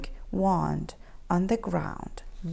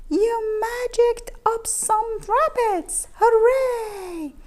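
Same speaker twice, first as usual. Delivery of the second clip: high, loud joyful cheer; 9 semitones higher, 4 dB louder than usual. A narrator's reading. Cats celebrating Keith's rabbit magic loudly. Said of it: "the other cats cheered".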